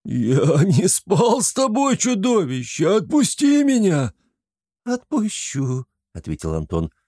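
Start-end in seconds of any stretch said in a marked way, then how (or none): 0.1-4.1 high, slow, wailing cry; sobbing plea. A narrator's reading. Flappy sobs at the monster to let him go. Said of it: "зарыдал". 4.8-5.8 high, slow hag reply; a hint of kikimora. Антон keeps up the kikimora voice to Flappy, high slow.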